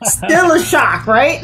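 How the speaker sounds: high, slow, laughing through the words. Real, not acted. Host laughing with his Arizona guest about the shock. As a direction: chuckle it out, amused.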